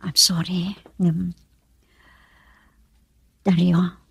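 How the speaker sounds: breathy whispered apology, barely voiced. Woman whispering to Dario about her illness. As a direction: whisper it like a confession.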